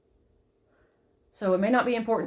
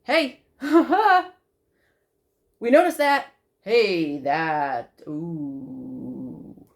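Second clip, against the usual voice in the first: inner voice; 5 semitones lower, much slower than usual. Retold or imitated. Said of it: "my intuition is telling me".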